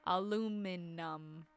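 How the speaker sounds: "aluminum" slowly, syllable by syllable, a-loo-min-num; ALUMINUM drawn out. Shown as a demonstration. Streamer puzzling over British usage, slow sounded-out ALUMINUM. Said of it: "like there is an N".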